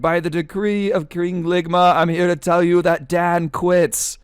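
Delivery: high, drawn-out royal proclamation; mock screaming king. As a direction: yell a decree like a hammed-up monarch. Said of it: "the king starts screaming".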